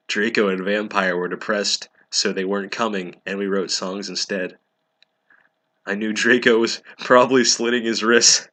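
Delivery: ordinary talk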